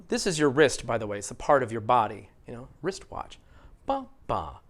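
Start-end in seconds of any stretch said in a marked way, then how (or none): 3.9-4.6 "Ba, ba" with a strong first beat, low second; stress pattern demo. Shown as a demonstration. Teacher showing learners the rhythm of wristwatch, stress pattern demo.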